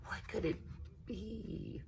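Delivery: hushed, breathy small question; whispered wonder. A narrator's reading. Little girl asking her cousin about the gift, whispered. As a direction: whisper it, half hopeful. Rosa drying her eyes.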